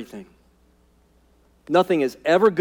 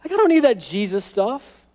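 dismissive outsider; 9 semitones higher than usual. Retold or imitated. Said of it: "and they go".

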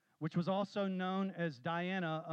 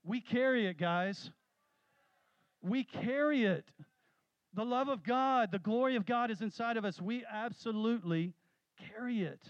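higher, louder emphatic preaching; preaching climax; 5 semitones higher, 4 dB louder than usual. Acted. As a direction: drive the point home to the congregation.

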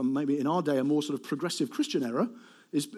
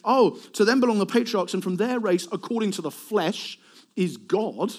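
higher, starting loud and easing off; hypothetical mock claim; 4 semitones higher, 4 dB louder than usual. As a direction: declaim it as an unthinkable claim. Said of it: "Nobody would ever say".

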